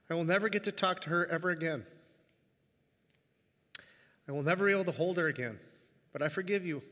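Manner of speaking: reading through tears, pausing to compose himself; moved, halting delivery; choked up. Real, not acted. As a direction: fight back tears while reading aloud. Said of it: "I wept, but it's pretty obvious".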